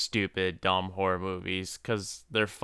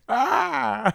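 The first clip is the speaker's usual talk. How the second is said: high, drawn-out "ah" of fake surprise; mock shocked cry. Cohost mimics the surprised leopard from the movie, mock shocked cry.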